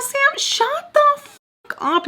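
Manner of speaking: exasperated yell telling Sam to shut up; drawn-out high yell. Retold or imitated. Telling Sam off mid-fight.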